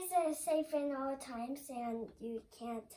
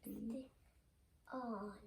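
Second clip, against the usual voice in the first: quiet first try; 4 semitones lower, 12 dB quieter, faster than usual. Real, not acted. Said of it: "Say it again".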